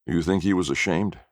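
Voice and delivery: usual speaking voice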